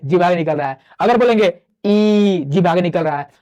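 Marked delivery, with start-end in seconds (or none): 1.0-2.4 slow held ई, tongue forward; drawn-out vowel ई. Shown as a demonstration. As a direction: stretch ई long. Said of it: "बस बोल के आप समझ जाएंगे".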